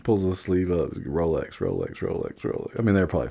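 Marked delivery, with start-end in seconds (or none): 0.9-2.8 very low, hushed hawking; secretive mock watch peddler; furtive street seller. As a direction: mutter the goods under your breath. Imitated street seller flashing watches.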